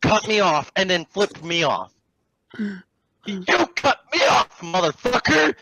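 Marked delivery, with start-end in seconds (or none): 2.6-5.5 high, indignant yelling; outraged retort at another driver; road-rage outburst. Retold or imitated. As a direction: stretch the accusation, then rise higher. Acted road rage at other driver.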